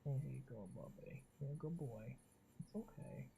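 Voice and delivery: hushed comforting pet talk; soft soothing. Real, not acted. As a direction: coo softly to calm him. Soothing the cat.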